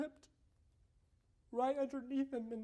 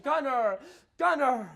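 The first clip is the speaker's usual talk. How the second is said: loud angry calling; screaming his name; 2 semitones higher, 10 dB louder than usual. Retold or imitated. Ogre dad stomping downhill yelling at son.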